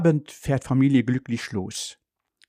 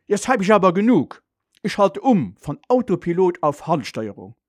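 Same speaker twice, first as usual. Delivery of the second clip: exasperated father in a raised pitch; losing patience; 6 semitones higher than usual. A narrator's reading. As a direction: put your foot down, fed up. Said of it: "Nun platzt Vater der Kragen". (verärgert, mit erhobener Stimme)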